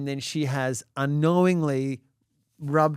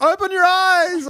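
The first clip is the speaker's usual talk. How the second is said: high, loud retold shout; frantic command; 8 dB louder than usual. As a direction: shout orders at a panicking child.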